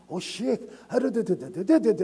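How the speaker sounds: lightly voiced caller gabbling on; imitating emotional caller. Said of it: "many times people call me".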